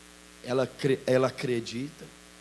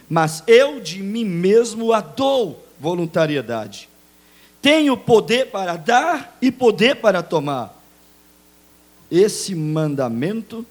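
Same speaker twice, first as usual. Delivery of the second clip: higher, louder reading of scripture; 8 semitones higher, 10 dB louder than usual. Real, not acted. Pastor reading Jesus' words to the church, proclaiming. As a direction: proclaim the verse, slowly at the end.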